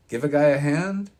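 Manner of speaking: put-on loud, high, strained old-man call; high old voice calling over. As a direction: shout for help from the car window. Old man calls from the Jeep.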